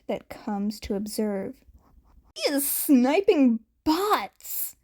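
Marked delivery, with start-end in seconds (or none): 2.3-4.7 higher, louder, slower gleeful cry; thrilled to see a student sniping bots; way too excited. A narrator's reading. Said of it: "way too excited".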